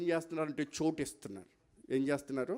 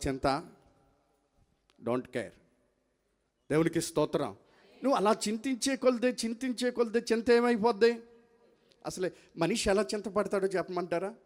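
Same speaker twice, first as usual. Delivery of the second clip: usual speaking voice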